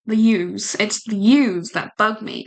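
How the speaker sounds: usual speaking voice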